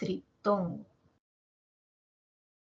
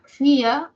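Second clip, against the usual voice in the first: "fía" with a strong, louder í; "fía" in two beats, stressed on í; 4 semitones higher, 9 dB louder than usual. Shown as a demonstration. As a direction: split "fía" as fí-a, hitting the first vowel. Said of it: "la más fuerte va a ser la I".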